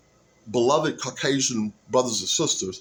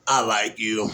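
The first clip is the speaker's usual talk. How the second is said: low, mumbled Sling Blade voice; Sling Blade impression; 5 semitones lower than usual. Vlogger practicing impressions, a low Sling Blade mumble. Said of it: "Sling Blade?".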